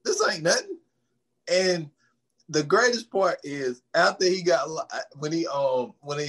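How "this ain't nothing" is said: scoffing, disbelieving, pitched way up; mock the boss brushing it off; incredulous boss. Boss scoffing at the evidence, high incredulous.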